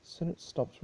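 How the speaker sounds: plain reading voice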